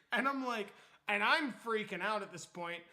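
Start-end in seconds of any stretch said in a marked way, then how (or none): none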